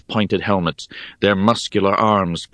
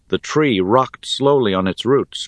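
plain reading voice

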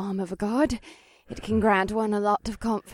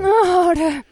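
high, loud plea in passion; urgent cry; 9 semitones higher, 10 dB louder than usual. Acted. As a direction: cry it out, desperate. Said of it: "Kalia whimpered".

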